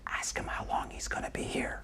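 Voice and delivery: hushed, voiceless stage whisper. Acted. Husband privately prompts his wife during the call, whispered. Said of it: "he whispers to you".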